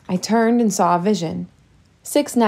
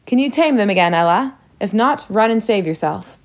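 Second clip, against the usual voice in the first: usual speaking voice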